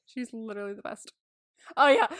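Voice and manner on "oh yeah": ordinary delivery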